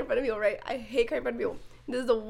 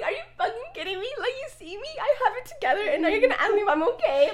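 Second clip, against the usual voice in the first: exasperated laughing rant; 7 semitones higher, faster than usual. Retold or imitated. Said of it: "I was like".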